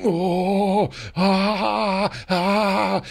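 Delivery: high, drawn-out mock moaning; imitating a wife moaning through contractions; imitated labor moans. Husband imitating his wife's contraction moans, high and drawn-out. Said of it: "So she'd be like".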